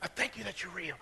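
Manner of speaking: hushed prayer. Retold or imitated. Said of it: "he begins to".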